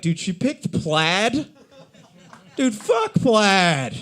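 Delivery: slow, high, outraged shirt; indignant shirt. Pretend. Shirt on the rack sees plaid chosen, slow outraged whine.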